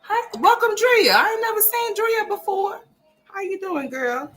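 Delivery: high, bright mock greeting; high sing-song greeting. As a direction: chirp the hello way up high.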